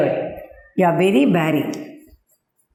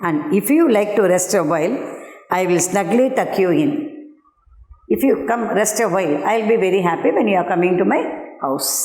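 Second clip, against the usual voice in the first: quick, eager invitation; fast coaxing spider; faster than usual. Acted. Teacher as spider hurrying to lure the fly, quickly. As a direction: rush the sweet offer along. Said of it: "said the spider to the fly".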